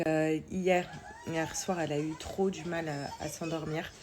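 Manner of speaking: ordinary talk, nothing marked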